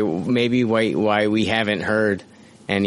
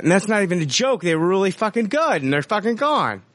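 high, fast, aggrieved outburst; exasperated rant; 8 semitones higher, faster than usual. Real, not acted. Host upset that good jokes are gone, exasperated. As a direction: protest the loss in a rush of frustration.

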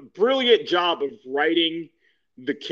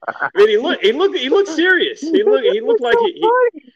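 excited; 9 semitones higher, 6 dB louder than usual. Real, not acted. Excited retelling, pitch way up.

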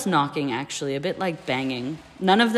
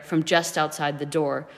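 ordinary delivery

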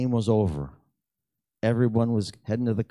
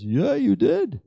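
high, slow, drawn-out yes; slow affirming reply; 13 semitones higher, 6 dB louder, much slower than usual. Retold or imitated. Friend confirming the prayer, slow and high. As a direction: lean into it slowly, a little awed.

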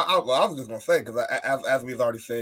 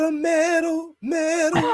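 excited; much slower than usual. Real, not acted. Excited cry at seeing medal.

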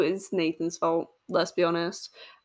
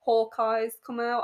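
ordinary talk

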